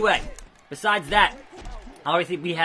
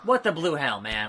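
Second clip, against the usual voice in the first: ordinary talk, nothing marked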